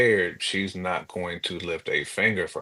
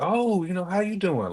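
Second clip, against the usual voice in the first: mock sweet greeting; 10 semitones higher than usual. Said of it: "that same person who pull up on you, like".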